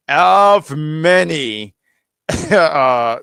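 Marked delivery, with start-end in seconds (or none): 0.1-1.7 very slow, stretched and louder; savoring each word, drawn right out; slow emphatic repeat. Shown as a demonstration. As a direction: drag out the words of many for emphasis.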